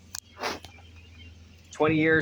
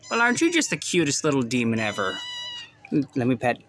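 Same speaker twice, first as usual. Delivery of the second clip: cooing pet talk; 3 semitones lower, 5 dB quieter than usual. Real, not acted. Cooing at baby goat.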